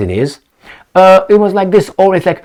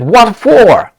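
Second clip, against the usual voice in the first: a hint of an Australian voice, higher and louder; light Australian accent; 8 semitones higher, 5 dB louder than usual. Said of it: "He said".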